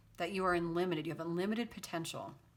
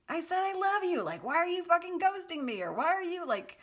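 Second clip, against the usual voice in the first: high, loud mock tirade; hammed-up screaming rant at a very high pitch; screaming message; 12 semitones higher, 5 dB louder than usual. Reader voicing a viewer berating a lover for ghosting, screaming. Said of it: "one of those Harry Potter screaming messages".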